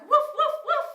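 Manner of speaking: high, loud pretend dog woofs; cheerful pretend woofing; playful barking. Shown as a demonstration. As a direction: bark like a happy puppy for the kids. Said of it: "I'm gonna bark".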